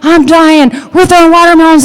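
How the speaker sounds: high, loud, urgent call; frantic brother. Retold or imitated. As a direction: panic over the phone, get here now. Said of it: "He says".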